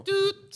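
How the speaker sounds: high loud doot; imitated siren blast; siren imitation. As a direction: mimic the siren. Mimicking the siren.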